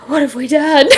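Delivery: loud laughing despair; laughing mock despair. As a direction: laugh through the line in mock horror. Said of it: "He's just like".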